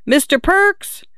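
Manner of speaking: high, searching name call. A narrator's reading. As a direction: call with a questioning upswing. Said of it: "she called out".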